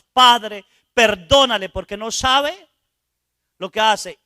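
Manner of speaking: loud, ringing Padre then a sustained cry; the crucified Jesus begging forgiveness for the sinner; proclaimed plea from the cross. Retold or imitated. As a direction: call out to heaven for mercy.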